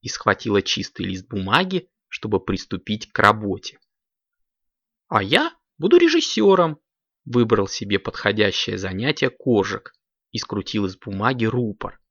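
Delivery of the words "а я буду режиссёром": high-pitched, keen little voice; childlike high announcement; eager kitten child. A narrator's reading. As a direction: claim it high like an excited kid. Kitten brother claims his role.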